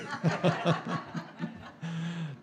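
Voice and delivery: hearty real laugh after the punchline. Real, not acted. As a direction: let a big laugh out at the joke. Laughing at his own joke.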